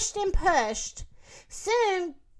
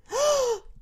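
high, startled gasp; sudden audible intake of surprise; 10 semitones higher than usual. A narrator's reading. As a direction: gasp in amazement. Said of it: "Gasped Emma and Lulu".

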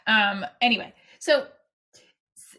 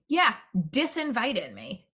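ordinary talk, nothing marked

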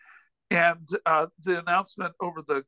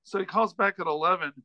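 ordinary delivery

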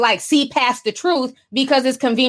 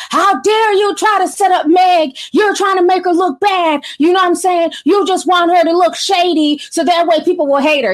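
raised, higher-pitched mock outrage; going off; 5 semitones higher, 3 dB louder than usual. Fans going off on Carl for setting up Meg, mock outraged. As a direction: retell an indignant telling-off, loud and high. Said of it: "They was going off on Carl".

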